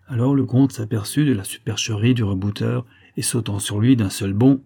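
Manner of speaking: ordinary delivery, nothing marked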